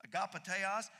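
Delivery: Agapeteos repeated with a rising end; Greek word. Shown as a demonstration. Pastor repeating Greek Agapeteos to congregation. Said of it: "the Greek is".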